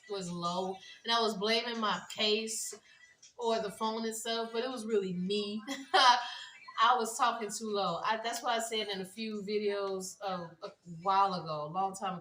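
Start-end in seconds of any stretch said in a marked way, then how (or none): 5.6-6.8 short, loud burst of laughter. Real, not acted. Vlogger admitting the fault was hers, laughing. Amused at her own mistake.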